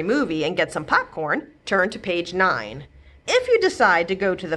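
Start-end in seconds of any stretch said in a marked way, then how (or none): none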